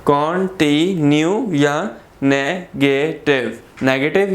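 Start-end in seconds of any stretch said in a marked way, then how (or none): 0.1-1.5 continue slowly broken into con-ti-nue; three syllables. Shown as a demonstration. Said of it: "three syllables होते हैं". 2.2-3.6 नेगेटिव slowly split as ne-ge-tiv; three syllables. Shown as a demonstration. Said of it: "three syllables हैं".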